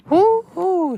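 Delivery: very high, loud squeaky whoop; high cartoon cheer; gleeful whoop. Naughty trucks ganging up on Toby, gleeful whoop. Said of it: "said the cars".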